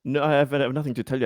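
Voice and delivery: ordinary talk, nothing marked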